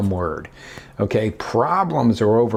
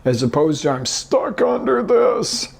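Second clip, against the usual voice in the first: higher, strained stuck voice; defeated, stuck; 7 semitones higher than usual. Shown as a demonstration. Coach shows being stuck, strained and higher. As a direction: sound crushed under the weight. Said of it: "do you see the difference in physiology".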